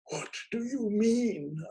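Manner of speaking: slow, high hammed-up line; melodramatic, stretched out; stagey question. A narrator's reading. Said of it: "and in a kind of hoarse whisper".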